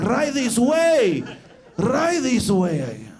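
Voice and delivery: slow, sly knowing voice; drawn-out sly host; shopkeeper. Retold or imitated. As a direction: usher him in like a secret. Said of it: "he's like".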